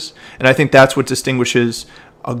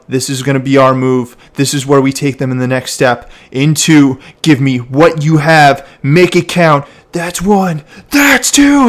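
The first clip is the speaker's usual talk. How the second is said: building to power. Shown as a demonstration. Coach models coxswain calling the shift, building to a shout. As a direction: call climbing steadily in pitch and volume to a shout. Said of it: "when they make that shift, when they bring that power".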